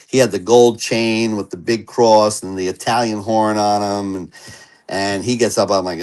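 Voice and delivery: ordinary talk, nothing marked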